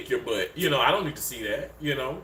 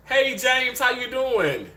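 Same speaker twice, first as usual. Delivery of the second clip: high-pitched hello, falling at the end; bright greeting call; 10 semitones higher, 3 dB louder, slower than usual. Real, not acted. Host greeting a viewer by name, high excited call. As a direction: sing out a friendly hello up high.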